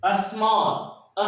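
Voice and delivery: Small stretched out very slowly; drawn-out demonstration. Shown as a demonstration. Teacher introduces the next example word, drawn out slowly. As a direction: draw small right out, sound by sound. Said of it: "next आपका है".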